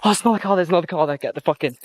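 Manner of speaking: high, frantic alarm; panicked. Real, not acted. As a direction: hurry everyone into car. Finding second car, fleeing.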